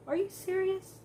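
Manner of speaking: can't believe it; incredulous. Real, not acted. Disbelief at husband texting friend.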